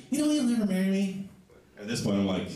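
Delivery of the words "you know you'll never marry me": high mock girlfriend falsetto. Comic quoting what she literally says, high mock voice. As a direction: pipe up in an imitated girlfriend voice. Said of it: "No, she literally says".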